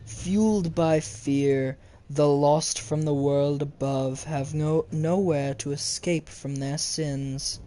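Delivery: measured game-lore narration; dramatic text reading. Acted. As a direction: read it out like an ancient decree. Reads game text aloud dramatically.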